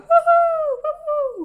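huge, high-pitched whoop of joy; loud cheer. Real, not acted. Host celebrating the listener's elopement with a loud whoop. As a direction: cheer at the top of your lungs. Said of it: "Sorry if I hurt your ears".

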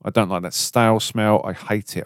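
ordinary talk, nothing marked

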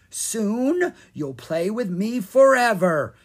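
slow sing-song, high and teasing; lilting creepy singsong. A narrator's reading. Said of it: "in a sing-song voice".

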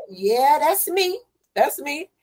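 bemused confirmation. Retold or imitated. Applicant confirming details to the caseworker, bemused. Confirming identity in stunned retelling.

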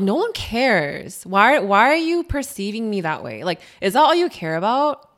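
usual speaking voice

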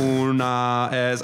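slow, halting beginner Spanish; broken Spanish. Retold or imitated. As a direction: sound out the Spanish word by word, badly. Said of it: "just terribly to 'em".

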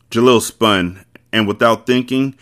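plain reading voice

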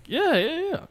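eager, high-pitched quick yeses; excited reply. Retold or imitated. Retelling own excited reply to uncle.

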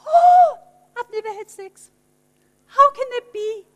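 high startled gasp then a hushed whisper; Mary caught off guard, pitched high. Acted. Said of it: "And she goes".

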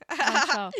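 loud laughing echo. Real, not acted.

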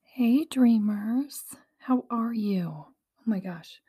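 ordinary talk, nothing marked

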